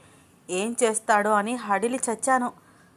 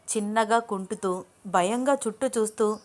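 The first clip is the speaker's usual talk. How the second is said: ordinary delivery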